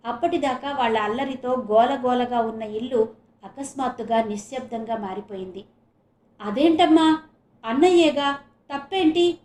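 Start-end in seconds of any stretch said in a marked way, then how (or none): 6.4-9.4 loud, high, baffled protest; can't see what she did wrong; puzzled protest. Acted. Daughter questions her mother's scolding, baffled and loud. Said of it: "విచిత్రంగా చూస్తూ అంది".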